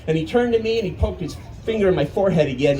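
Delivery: usual speaking voice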